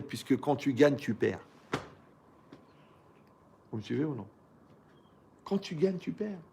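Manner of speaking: normal talking voice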